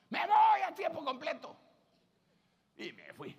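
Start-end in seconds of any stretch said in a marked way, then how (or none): 0.1-1.5 shouted, high and loud; loud declaration. Retold or imitated. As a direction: proclaim it at full volume. Announcing decision, much louder. (grita con fuerza, agudo y fuerte)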